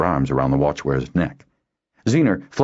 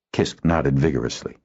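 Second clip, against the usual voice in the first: usual speaking voice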